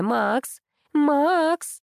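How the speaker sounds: high-pitched insistent call, building. A narrator's reading. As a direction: summon him with a rising cry. Angry mother-in-law calling her son.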